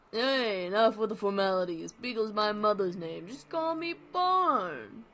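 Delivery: breezy comic dog waving off formality; Barnaby voice. Acted. Barnaby telling the host to skip formalities, brassy. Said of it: "how am I gonna do Barnaby?".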